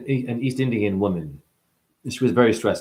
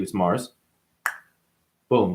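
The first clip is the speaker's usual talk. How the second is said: normal talking voice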